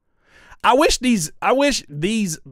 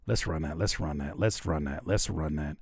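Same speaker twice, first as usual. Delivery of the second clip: fading repetition; 10 semitones lower, 6 dB quieter than usual. Real, not acted. Streamer agreeing to run the clip, fading out. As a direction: repeat it, sinking to a low murmur. Repeats the line, fading away.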